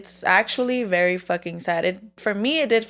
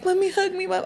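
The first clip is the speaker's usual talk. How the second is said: put-on child's voice, much higher; little son; 11 semitones higher than usual. Mom voicing her son begging for a hug, child's voice. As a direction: plead like a small boy wanting a hug.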